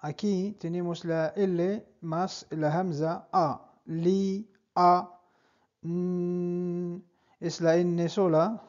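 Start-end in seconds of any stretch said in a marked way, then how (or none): none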